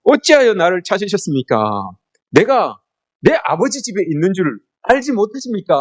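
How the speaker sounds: high-pitched, innocent-sounding retort; cheeky young Jesus. Acted. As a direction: act out a child's cheeky protest. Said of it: "하신다는 말씀이 더 가관입니다".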